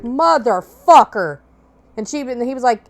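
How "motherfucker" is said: slow, loud, dismayed swear; mother swearing as she sees cops; drawn-out curse. Retold or imitated. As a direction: let it drag out as the cops appear. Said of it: "And she's like".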